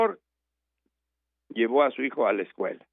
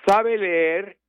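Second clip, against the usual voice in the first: high, louder questioning schoolmaster; teacher's question; 9 semitones higher, 5 dB louder than usual. Retold or imitated. Schoolmaster quizzing the farmer, high and loud. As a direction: ask it like a sceptical teacher.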